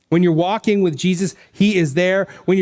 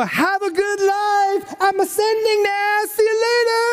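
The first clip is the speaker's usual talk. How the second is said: high, slow, drawn-out mock goodbye, like someone shrugging off his friends; casual breezy farewell; 14 semitones higher than usual. Pastor mocking a Jesus who just peaces out: high breezy goodbye. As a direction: play a cheery Jesus waving bye on his way up. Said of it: "he doesn't just peace out and go".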